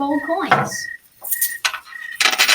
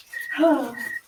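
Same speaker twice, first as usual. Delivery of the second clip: audible tired sigh; weary sigh. Acted. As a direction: let out a weary breath. Weary brother by the campfire.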